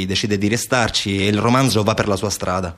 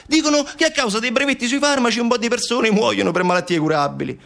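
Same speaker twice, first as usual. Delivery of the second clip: high, impassioned outburst; indignant Montalbano; 13 semitones higher than usual. Acted. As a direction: plead urgently against the world's injustice.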